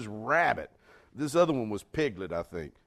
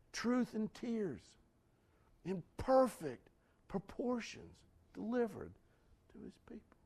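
soft, high, tender preacher voice; hushed preaching; 7 semitones higher, 6 dB quieter than usual. Acted. Preacher telling how Jesus wept with Mary, hushed. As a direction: drop to an emotional hush, pitched high.

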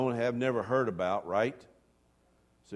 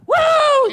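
very high, loud woo; a big extreme-sports whoop; whooping cheer; 16 dB louder than usual. Retold or imitated. Imitating Gabe's cheer.

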